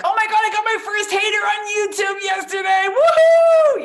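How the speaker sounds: high, loud cheering yell; excited shout. Retold or imitated. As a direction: cheer it like great news. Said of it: "was like".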